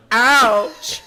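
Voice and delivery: loud high yelp of pain; sharp cry of pain. Acted. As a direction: yelp it like a twisted ankle. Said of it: "she cried".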